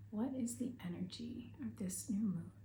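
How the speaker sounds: soft breathy whisper, voice dropped right down; whispered question. Real, not acted. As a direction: breathe the question as if sharing a secret. Opening question of the reading.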